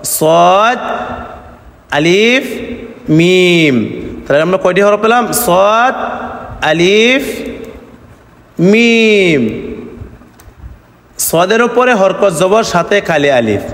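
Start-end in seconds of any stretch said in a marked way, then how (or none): 0.0-3.8 সদ, আলিফ and মিম each stretched long; name each letter slowly, holding the vowel; separate, sustained letter names; drawn-out letter names. Shown as a demonstration. Teacher names letters for learners. 5.4-9.4 সদ, আলিফ and মিম each stretched long; name each letter slowly, holding the vowel; separate, sustained letter names; drawn-out letter names. Shown as a demonstration. Teacher names letters for learners.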